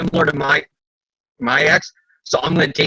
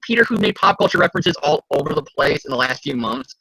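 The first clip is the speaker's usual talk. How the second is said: ordinary talk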